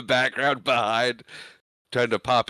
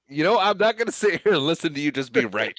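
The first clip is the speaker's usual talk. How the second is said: laughing, higher and louder mock huff; laughing protest; 8 semitones higher, 4 dB louder than usual. Real, not acted. Teasing her cohost, laughing mock indignation. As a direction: fake outrage through laughter.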